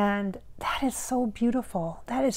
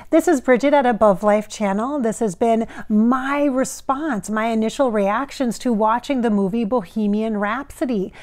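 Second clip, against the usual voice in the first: outro announcement; 4 dB louder than usual. Acted.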